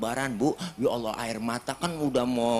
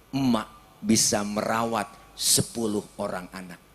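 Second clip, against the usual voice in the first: usual speaking voice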